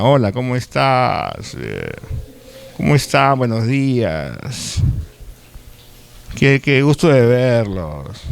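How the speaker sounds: slow, lazy Lima accent greeting. Shown as a demonstration. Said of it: "yo hablo así porque soy limeño". (saludo lento con acento limeño)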